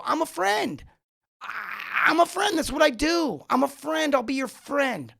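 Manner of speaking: put-on high, excited little-kid voices all clamoring; eager kids volunteering. A crowd of playground kids volunteering, eager high voices. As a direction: kids waving their hands begging to be picked.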